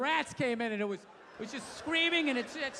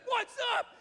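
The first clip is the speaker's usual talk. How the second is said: excited gorilla voice; 12 semitones higher, much slower than usual. Pretend. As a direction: greet her like a hyped-up ape, much higher. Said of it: "So the thing's like".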